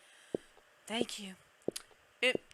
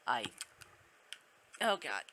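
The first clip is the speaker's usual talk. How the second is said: ordinary talk, nothing marked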